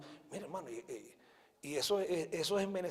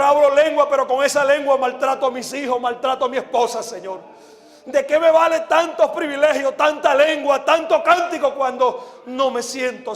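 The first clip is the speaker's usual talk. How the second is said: fervent, raised prayer, each phrase falling on "Señor"; oración de confesión; 4 semitones higher, 12 dB louder than usual. Real, not acted. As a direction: plead for mercy, leading the congregation. Dirige una oración de confesión. (oración ferviente de arrepentimiento)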